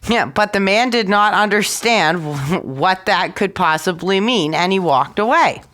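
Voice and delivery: ordinary delivery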